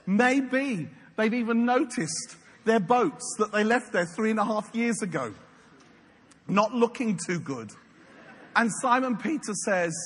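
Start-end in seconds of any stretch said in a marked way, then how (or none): none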